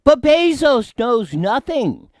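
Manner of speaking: mock high voice. Mocking high voice.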